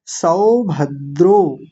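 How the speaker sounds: सौभद्रो very slowly, सौ open and द्रो closed; slow teacher demo; slow syllables. Shown as a demonstration. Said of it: "पहली मात्रा को बोलेंगे सौ".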